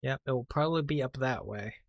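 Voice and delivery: usual speaking voice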